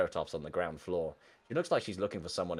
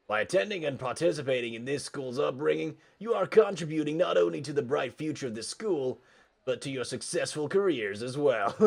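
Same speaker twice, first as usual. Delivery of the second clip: raised, emphatic podium voice; higher, louder student-leader oratory; class president's rally speech; 7 semitones higher, 3 dB louder than usual. Acted. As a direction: proudly address the cheering school.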